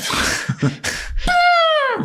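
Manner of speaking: a sudden high burst of laughter; giddy laugh of a man who just survived. Real, not acted. Laughing at surviving elephant charge.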